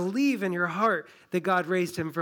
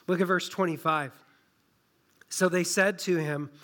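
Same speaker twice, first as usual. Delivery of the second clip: normal talking voice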